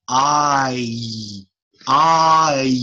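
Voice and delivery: I split into two sounds; diphthong demo. Shown as a demonstration. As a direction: glide I slowly. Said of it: "that's two sounds".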